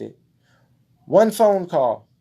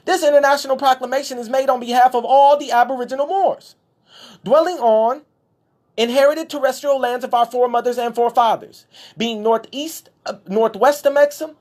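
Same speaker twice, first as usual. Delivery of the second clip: higher, raised, declaiming voice; formal proclamation reading; 8 semitones higher, 4 dB louder than usual. Acted. Reading his proclamation aloud to the viewers, declaiming. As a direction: read it out like an official decree.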